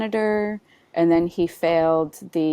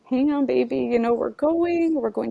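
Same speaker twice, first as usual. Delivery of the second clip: put-on soothing baby through tears; 9 semitones higher than usual. Mother in labour reassures her baby Rowan, high and tender. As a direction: comfort the unborn baby, half laughing. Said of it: "I just spoke to Rowan and I said".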